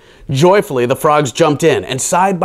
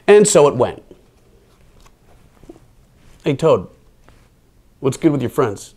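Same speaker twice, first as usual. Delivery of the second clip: ordinary delivery, nothing marked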